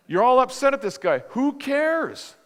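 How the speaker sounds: loud, high-pitched scolding; acted outburst of impatience; exasperated rebuke. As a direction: scold with rising pitch into the question. Acted rebuke of himself, in face.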